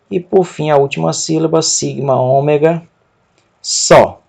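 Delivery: normal talking voice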